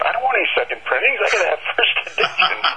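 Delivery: high, quick mock outrage with a laugh; indignant protest. Bookseller refuses the supplier's second printings, laughing indignation.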